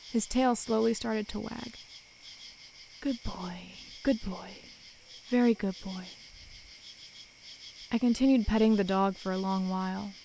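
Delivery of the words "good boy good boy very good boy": soothing. A narrator's reading. Comforting the dog again.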